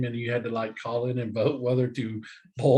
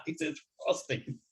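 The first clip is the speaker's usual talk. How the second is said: high drawn-out punchline; 10 semitones higher, 8 dB quieter, slower than usual. Pretend. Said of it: "joke punchline".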